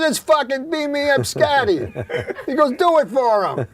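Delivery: high, eager doorman showing off a find; excited doorman. Retold or imitated. Doorman bragging, asks for catchphrase.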